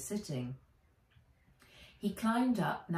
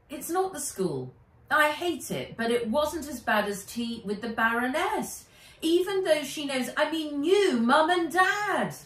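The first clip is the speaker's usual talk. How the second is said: put-on high, louder girl voice, rising in distress; a girl voice climbing higher as she gets upset; upset girl Nat; 9 semitones higher, 6 dB louder than usual. A narrator's reading. Nat tells her guardian about the Baroness: high, upset girl voice.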